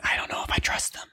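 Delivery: hushed conspiratorial whisper. Real, not acted. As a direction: whisper it so they can't hear. Secretive aside about sponsor.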